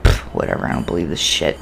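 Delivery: low, soft scoffing aside; dismissive inner thought. Retold or imitated. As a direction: mutter it sceptically to yourself. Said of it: "I'm thinking in my mind".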